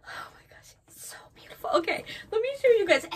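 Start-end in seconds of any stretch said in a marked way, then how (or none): 0.1-1.7 hushed, awed whisper; real awestruck whisper. Real, not acted. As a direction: breathe it out in awe.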